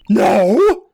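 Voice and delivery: high, loud cry of refusal; panicked shout. Acted. Lawyer asked if the witness is ready, shouting. Defense blurts out objection in game.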